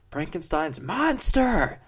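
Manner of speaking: mock scream building to a high, loud cry; drawn-out, strained mock yell from the background; imitated screaming. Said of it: "And he's just screaming".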